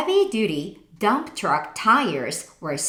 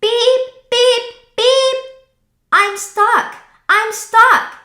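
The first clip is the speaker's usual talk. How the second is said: high, loud frantic calling; desperate beeps; 10 dB louder than usual. Acted. The stuck truck calling to the farm animals. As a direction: shout for rescue, stuck and scared.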